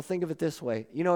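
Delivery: ordinary talk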